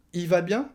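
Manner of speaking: "il va bien" becomes "i va bien"; bare "i" for "il", high rising question; dropped L. Shown as a demonstration. Reply about the brother on the phone, L swallowed. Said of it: "le il devient i à l'oral".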